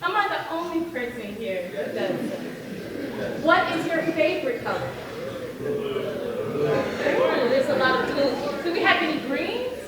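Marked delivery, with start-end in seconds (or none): 3.4-9.8 calling out to crowd. Real, not acted. Asks audience for favorite colors.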